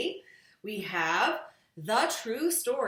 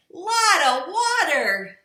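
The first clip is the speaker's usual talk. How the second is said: put-on louder, higher and slow baby shout; 10 semitones higher, 13 dB louder, slower than usual. A narrator's reading. Baby Blair shouting about the water. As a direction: call it out big and slow like a toddler. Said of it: "shouted Baby Blair".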